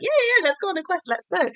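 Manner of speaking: high, rapid eager burst; squeaky-high, rushed enthusiasm; excited. Retold or imitated. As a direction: imitate someone bubbling over with eagerness. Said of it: "he gets so excited".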